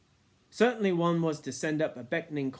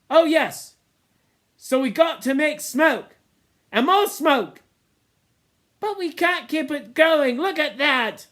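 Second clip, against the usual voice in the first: high, loud bursting urgency; sudden urgent realisation; 13 semitones higher, 12 dB louder than usual. A narrator's reading. Ralph suddenly remembers the smoke, urgent and loud. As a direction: it all comes back at once.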